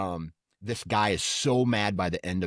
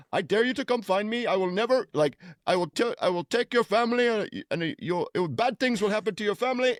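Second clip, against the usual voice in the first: high, mock-angry Indian accent, much higher; 12 semitones higher than usual. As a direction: threaten in a hammed-up Indian accent. Scammer threatens in voiced accent.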